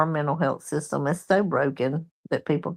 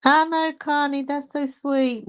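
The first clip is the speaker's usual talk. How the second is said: touched and sweet; 8 semitones higher than usual. Real, not acted. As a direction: melt a little at the kind words. Touched by a viewer's comment.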